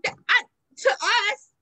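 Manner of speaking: high, loud, laughing through the words. Real, not acted. Answering her cohost about logic, laughing high. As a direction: giggle as you answer.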